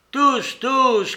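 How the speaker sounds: high, loud, insistent call; excited cry. A narrator's reading. Mitya wants everyone drinking, shouting it. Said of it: "cria Mitya".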